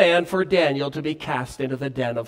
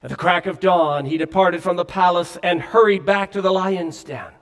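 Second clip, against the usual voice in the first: ordinary talk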